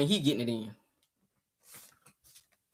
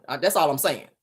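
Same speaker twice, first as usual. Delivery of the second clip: normal talking voice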